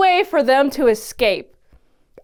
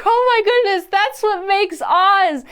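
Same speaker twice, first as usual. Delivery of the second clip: amazed realization; 7 semitones higher than usual. Retold or imitated. Viewer realizing how Oz was raised, high amazed exclamation. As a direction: play up a stunned revelation. Retells own startled realization.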